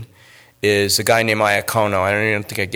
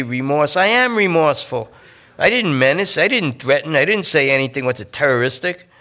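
higher, anxious pleading addict; pleading junkie; 4 semitones higher than usual. Acted. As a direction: push them to check, nervous and needy. Lester argues his case.

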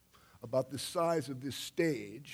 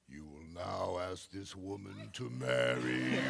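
inner voice; 7 semitones lower than usual. Retold or imitated. Inner voice commanding him.

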